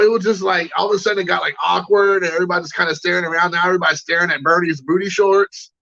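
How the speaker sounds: normal talking voice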